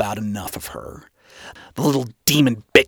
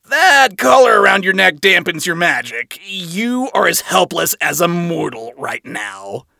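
smug, taunting; 5 semitones higher, 4 dB louder than usual. Acted.

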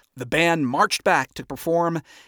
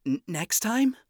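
high, stammered surprise; confused stammer; 8 semitones higher than usual. Acted. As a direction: ask in confusion. Confused question.

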